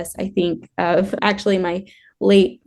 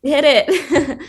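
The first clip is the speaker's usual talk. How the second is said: laughing call; 7 semitones higher than usual. Pretend. Echoing the skier's call.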